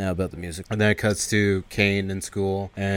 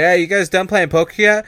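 raised, louder call across the room; lightly voiced junkie; 9 semitones higher, 8 dB louder than usual. Junkie on the couch calls to card players, mock calling out.

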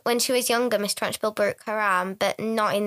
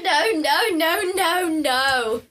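mock frantic refusal; 6 semitones higher than usual.

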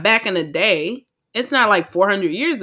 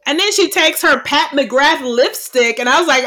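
ordinary delivery

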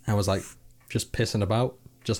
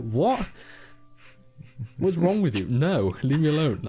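baffled outburst; 5 semitones higher, slower than usual. Retold or imitated. Mock reaction to friendly staff.

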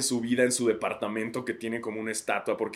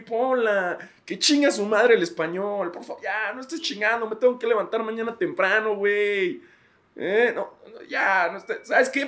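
high, whiny, fed-up father voice for the academy; the Spanish academy as an exhausted dad; tired RAE as dad; 9 semitones higher than usual. Acted. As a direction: whimper it like a sleepy dad pestered by questions. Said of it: "es lo mismo".

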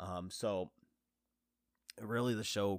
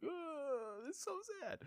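soft, squeaky high whimper; mock weeping; 8 dB quieter than usual. Moved viewer reliving the letter scene, mock weeping. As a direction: whimper it tiny and high, pretending to cry. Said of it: "I absolutely bawled my eyes out".

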